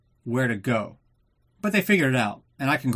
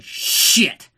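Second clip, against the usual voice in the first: mock curse; 7 semitones higher, 6 dB louder than usual. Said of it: "he's like".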